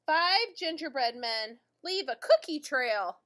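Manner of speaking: ordinary delivery, nothing marked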